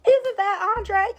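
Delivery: mock suspicious spotting; suspicious surprise. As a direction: catch him in the lie. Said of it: "Vanessa's like".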